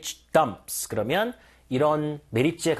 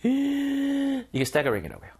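amazed gasp; 9 semitones higher, much slower than usual. Shown as a demonstration. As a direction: gasp high, then stretch the words out slowly. Teacher acts amazement again.

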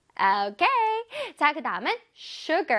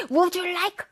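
abrupt, higher-pitched would you like; unfriendly wrong example; 5 semitones higher, 3 dB louder, much slower than usual. Shown as a demonstration. Teacher showing how not to offer food, brusque. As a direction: demand it curtly, as a mock bad example. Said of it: "아니죠?".

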